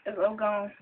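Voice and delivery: ordinary talk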